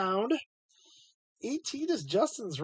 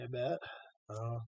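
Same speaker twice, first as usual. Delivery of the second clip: ordinary talk, nothing marked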